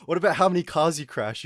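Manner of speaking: loud, high jeering question; taunting. Retold or imitated. Retold taunting of the brother.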